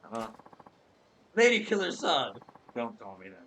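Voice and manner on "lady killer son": higher, excited fawning clerk voice; high excited salesman flattering a stranger; eager clerk. Acted. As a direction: gush over the customer like a pushy salesman. Clerk again.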